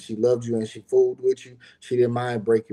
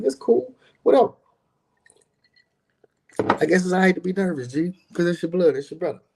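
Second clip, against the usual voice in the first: much higher, offhand voice, brushing it off; mock dismissive; 8 semitones higher, 3 dB louder, faster than usual. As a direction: act too cool to care, like it's no big deal. Acting out his own reply.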